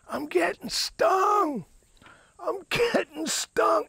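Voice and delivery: very high, drawn-out comic wail; owl guy's mock scream. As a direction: imitate a bandmate wailing mid-song, stretched and high. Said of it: "he leans over and he goes".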